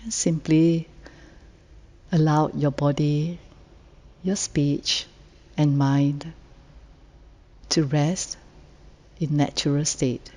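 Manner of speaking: guided meditation. Real, not acted. Meditation leader inviting the group to settle and let go, calm and unhurried. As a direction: gently lead sitters into rest, phrase by phrase.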